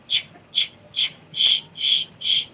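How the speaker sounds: voiceless ch, ch then sh, sh, no vowel; ch and sh sounds. Shown as a demonstration. Teacher to class, modelling ch turning to sh, unvoiced. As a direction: hiss out ch, ch, then switch to sh, sh. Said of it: "sounds like ch".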